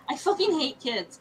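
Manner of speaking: ordinary delivery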